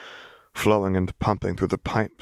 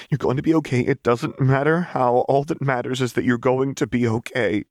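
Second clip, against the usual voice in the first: reassuring; 6 semitones higher than usual. Acted. Lux reassuring panicking Jinx.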